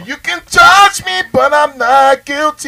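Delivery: high defiant mock voice. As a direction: proclaim innocence in a high voice.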